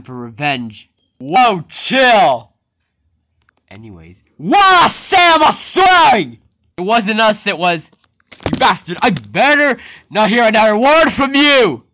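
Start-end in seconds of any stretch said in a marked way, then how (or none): 4.4-6.4 high, loud, whiny tattletale cry; tattling shout. Acted. Tattler shouting to Sam about the swearing, loud and high. 9.0-11.8 angry warning. Acted. Boss threatens the swearer.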